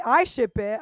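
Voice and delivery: usual speaking voice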